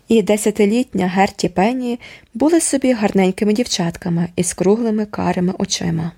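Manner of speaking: ordinary delivery, nothing marked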